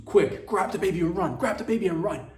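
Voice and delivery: loud, high urgent command; mock-panicked yell; urgent shout. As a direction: bark it like a getaway order. Urgent mock advice to snatch baby.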